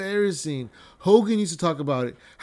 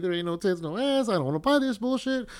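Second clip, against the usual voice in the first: mock fan voice, pitched higher as it goes; whiny disappointed fan; 4 semitones higher, 3 dB quieter than usual. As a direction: whine it like a cheated customer.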